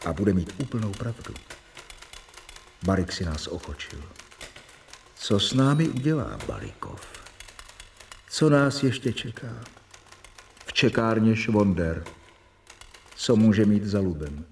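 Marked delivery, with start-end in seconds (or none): none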